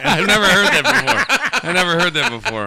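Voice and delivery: high, loud laughing words; cracking up at the joke. Real, not acted. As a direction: talk through real laughter.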